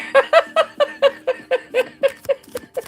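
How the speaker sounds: long high cackle; high, rapid peals of laughter; real laughing fit. Real, not acted. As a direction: laugh helplessly at a repeat coincidence. Laughs at yet another birthday card.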